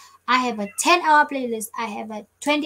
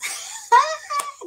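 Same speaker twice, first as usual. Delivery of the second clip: high, gleeful laugh; amused cackle at yourself; high burst of laughter. Real, not acted. Amused at own accent attempt.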